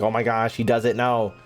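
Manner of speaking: ordinary talk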